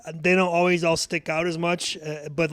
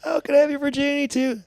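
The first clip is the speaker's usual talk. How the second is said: much higher, mock-eager voice; Seth Green impression; 10 semitones higher than usual. Shown as a demonstration. Friend doing his Seth Green impression on request, much higher. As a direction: ask it in a squeaky, eager voice. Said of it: "my Seth Green impersonating".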